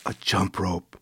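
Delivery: soft gasp. Acted. Boy discovers a jump rope, soft gasp. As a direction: gasp in quiet delight. Finds gift in the box.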